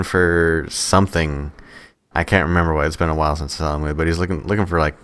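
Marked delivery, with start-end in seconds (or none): none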